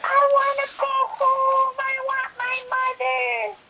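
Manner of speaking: high, pleading little bird; homesick. Acted. Baby bird wanting home, high pleading voice. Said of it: "said the baby bird".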